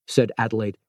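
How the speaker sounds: ordinary delivery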